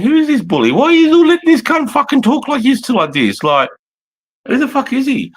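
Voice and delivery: high, loud, incredulous outburst about the bully; retold outrage. Retelling his outrage at the bully, high and loud. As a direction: squeal it out, disbelieving and loud.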